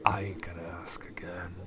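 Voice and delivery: quiet final warning; menacing whisper. Acted. Boss warning the hiding stranger, whispered menace. NPC threatening hidden player.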